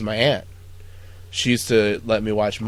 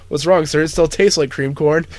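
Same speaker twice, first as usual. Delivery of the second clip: innocent cook; 8 semitones higher, 12 dB louder than usual. Retold or imitated. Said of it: "He goes like".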